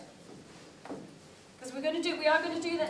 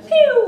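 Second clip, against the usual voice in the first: playful high pew; pew sound effect; 12 semitones higher, 20 dB louder than usual. Shown as a demonstration. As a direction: zap it out like a toy laser. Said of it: "make some sort of noise".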